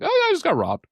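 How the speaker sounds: hammed-up high-pitched casual announcement; mock breezy news. Retells telling a friend the news.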